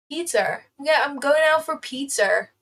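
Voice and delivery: imitated American, r on the end of pizza; American accent. Imitating Americans going out for pizza, American accent. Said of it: "how do American people say it?".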